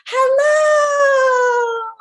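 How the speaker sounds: high, loud, drawn-out sweet hello; as nice as possible. Shown as a demonstration. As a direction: greet sweetly in a high puppet voice. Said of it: "as nice as we can".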